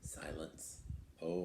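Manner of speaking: usual speaking voice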